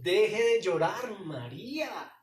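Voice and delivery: Jesus telling Mary to stop crying. Acted. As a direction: firmly tell her to stop crying. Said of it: "lo que quiere el Señor".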